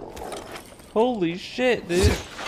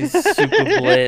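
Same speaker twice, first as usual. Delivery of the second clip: French voice; 9 semitones higher than usual. Shown as a demonstration. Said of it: "in the voice".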